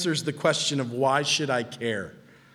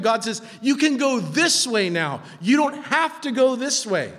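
raised, driving preacher's voice; impassioned preaching; 10 semitones higher, 5 dB louder than usual. Real, not acted. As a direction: preach it higher, with building energy.

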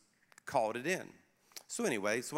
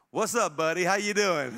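loud, higher-pitched buddy greeting; mock-cheerful greeting; 4 semitones higher, 9 dB louder than usual. Dad leaning into police car window to arrested son, cheery greeting. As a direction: greet him loudly like an old pal. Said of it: "I need to do a little differently".

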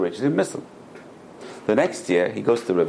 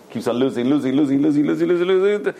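escalating repetition; 4 semitones higher, 4 dB louder than usual. Retold or imitated. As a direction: pitch climbing with each repeat, getting louder. Piling up losses as fortune falls.

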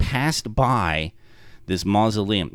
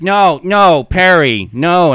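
higher, louder hurried no; Glumley frantically hushing Perry; panicked denial; 6 semitones higher, 8 dB louder, slower than usual. Retold or imitated. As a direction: shout him down before he says more. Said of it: "Glumley's like".

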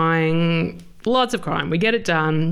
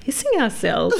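ordinary delivery

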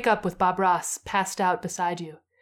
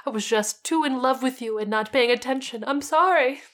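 upset pleading; 7 semitones higher, 3 dB louder than usual. Acted. Bob scolding then apologizing.

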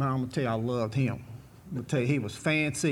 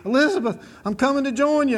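playful mock heart-attack cry, higher and a little louder; a cowboy hamming up a fake heart attack after a great ride; Fred Sanford; 8 semitones higher, 3 dB louder than usual. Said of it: "He'd act like he's having a heart attack".

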